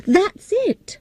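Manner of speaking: high, eager burst of realisation; girl suddenly sees the answer, excited; excited discovery. Acted. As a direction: snap onto the idea with delight. Sudden idea.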